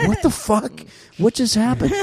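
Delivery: high, mock-confused voice; dazed bewilderment. Imitating dazed man with broken nose.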